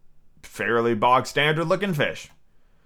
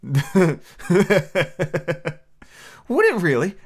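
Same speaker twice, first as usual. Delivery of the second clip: raised, surprised question; great surprise; 5 semitones higher, slower than usual. A narrator's reading. Alice answering the Mock Turtle, greatly surprised. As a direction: ask it in surprise. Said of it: "in a tone of great surprise".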